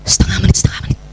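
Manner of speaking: urgent repeated whisper; whispered reminder. Acted. Mother reminding herself of the time limit, whispered reminder. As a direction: whisper it to yourself.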